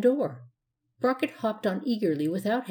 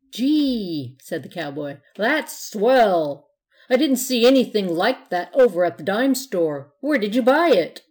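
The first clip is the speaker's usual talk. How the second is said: impressed; 2 semitones higher, 7 dB louder than usual. Acted. Impressed kid admiring the nose.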